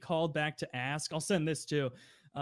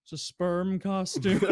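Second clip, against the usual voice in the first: slow deadpan admission; 3 semitones higher, 4 dB louder, slower than usual. Retold or imitated. Customer admits the costume.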